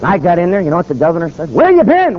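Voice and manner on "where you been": impatient demand. Retold or imitated.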